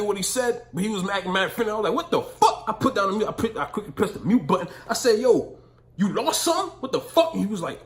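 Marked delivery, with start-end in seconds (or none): 1.9-2.6 much higher, loud shocked yelp; startled outburst. Retold or imitated. Stranger leans into his car at a red light, shocked yelp. 5.2-7.3 high, loud, rattled challenge; retold confrontation. Telling the old man at his car window, loud alarmed challenge.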